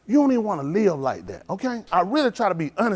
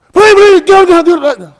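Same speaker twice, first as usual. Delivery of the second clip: much higher and louder, panicked begging; frantic pleading; 11 semitones higher, 16 dB louder than usual. Retold or imitated. Friend begs the officer to leave, frantic high shout. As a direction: yell it like the cops are coming.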